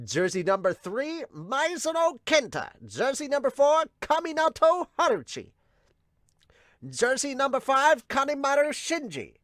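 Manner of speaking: high-pitched stadium roster call; announcer voice. Acted. Host calling out the team's jersey numbers, announcer voice. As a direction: announce each name to the crowd.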